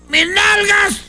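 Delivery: very high comic wail; panicked frog. Acted. Frog after the train passed, high panicked shout. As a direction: cry out high over what is lost. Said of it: "la rana se dio cuenta y dijo".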